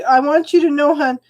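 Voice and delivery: usual speaking voice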